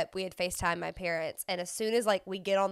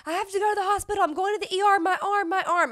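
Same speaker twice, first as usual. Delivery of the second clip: high, loud panicked cries; imitating a bleeding man running to his car; panicked injured man; 11 semitones higher, 6 dB louder, faster than usual. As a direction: yell in pain and panic. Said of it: "running away to his car going".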